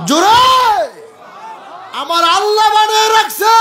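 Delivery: very high, full-voiced preacher's cry; rousing shout. Real, not acted. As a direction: shout to fire up the congregation. Preacher rousing crowd to respond. (উঁচু গলায় ওয়াজের হুংকার)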